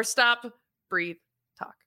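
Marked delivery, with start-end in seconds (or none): none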